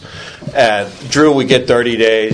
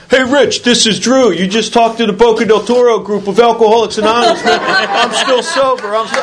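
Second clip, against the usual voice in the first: put-on much higher, delighted voice of an old friend, laughing; Drew on the phone; 12 semitones higher than usual. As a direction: play Drew calling his sponsor with joyful news. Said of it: "the last voice is".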